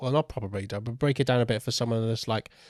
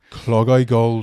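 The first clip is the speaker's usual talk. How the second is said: Clog Eye Gold in a louder Welsh lilt; Welsh accent; 11 dB louder, much slower than usual. Shown as a demonstration. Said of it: "If you're doing a Welsh accent, it sounds more".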